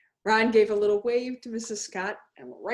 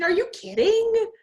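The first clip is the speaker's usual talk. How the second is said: put-on much higher, louder incredulous kid; can't believe he'd skip basketball; disbelief; 13 semitones higher, 6 dB louder than usual. A narrator's reading. Carl teasing Ron about skipping basketball, high and incredulous.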